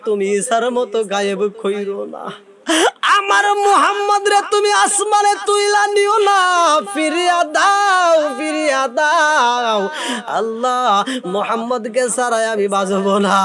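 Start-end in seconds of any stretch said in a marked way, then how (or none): 3.2-9.8 high, chanted cry of pleading; desperate wail. Acted. Grandfather begs God to give the boy back, desperate wail. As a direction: beg at the top of a lament, stretching the endings.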